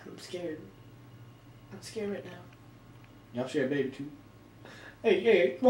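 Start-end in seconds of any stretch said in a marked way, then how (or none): none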